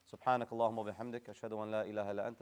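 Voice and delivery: very rapid, soft, low recital; closing prayer. Real, not acted. Teacher ends the class with a prayer, quick soft murmur. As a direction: rattle off the supplication, fading away.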